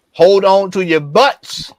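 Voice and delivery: hype announcer. Real, not acted. Host teasing a special guest to listeners, hype announcer. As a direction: stretch it out slowly to tease the reveal.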